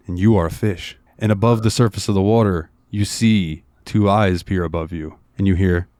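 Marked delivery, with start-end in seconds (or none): none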